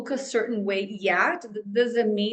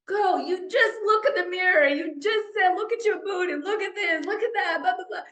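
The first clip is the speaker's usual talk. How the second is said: scolding herself; 8 semitones higher than usual. Retold or imitated. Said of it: "I was like".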